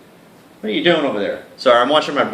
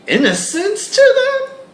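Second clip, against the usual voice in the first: way higher, louder on innocence; high squeak; 6 dB louder than usual. Acted. Describing the other girls' innocence in a high squeak. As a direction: squeak the word.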